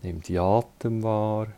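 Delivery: ordinary talk, nothing marked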